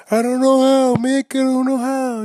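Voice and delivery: high, loud, desperate cry to his trainer; hammed-up yell of a lost fighter; bad impersonation of a yell. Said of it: "I guess that's a bad impersonation".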